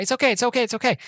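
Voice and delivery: high, repeated mock reassurance; Wayne. Driver soothing after the mishap, high acted insistence. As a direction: imitate a flustered man insisting all is fine. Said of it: "Wayne's like".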